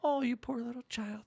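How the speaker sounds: hammed-up pity for a little one; mock coddling. Parent mockingly coddling a child who said friggin, fake sympathy.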